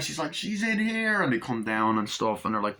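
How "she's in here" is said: high-pitched acted call; mock alarm call. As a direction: alert the others downstairs. Acts out woman alerting others.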